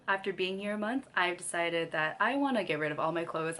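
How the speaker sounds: ordinary delivery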